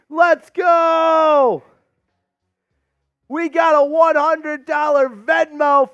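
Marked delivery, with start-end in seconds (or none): 0.1-1.6 celebratory shout. Real, not acted. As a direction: yell it out like a hype man. Celebrating the donation.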